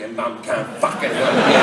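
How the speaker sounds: put-on low, villainous growl at a child; hammed-up threatening dad; mock menace. Acted menacing retort to child.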